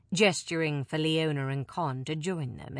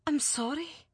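shaken apology; 9 semitones higher than usual. Acted. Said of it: "she said again".

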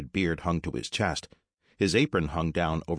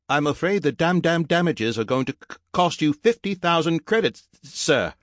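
high, loud stuttering robot; sticking on syllables like a glitching machine; formal robot servant, pitched high, stuck on words; robot speech impediment; 11 semitones higher, 5 dB louder than usual. Acted. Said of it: "fix your goddamn speech impediment".

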